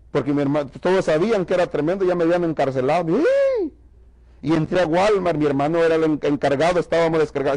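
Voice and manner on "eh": calling out. Retold or imitated. Manager calls out on seeing him.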